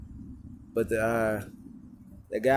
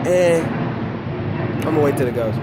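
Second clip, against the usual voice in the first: much louder, talking over noise; raised voice; 4 semitones higher, 6 dB louder than usual. Real, not acted. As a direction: project over a passing din. Talking over a passing loud noise.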